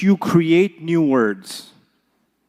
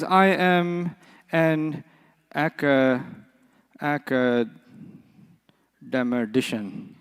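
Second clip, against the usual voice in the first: very slow, quieter "academician", dragged out; pompous scholar; 5 semitones lower, 8 dB quieter, much slower than usual. Retold or imitated. Teacher imitating a scholar's pompous slow answer. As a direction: draw it out like a self-important scholar. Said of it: "somebody says to a scholar".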